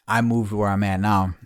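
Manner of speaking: normal talking voice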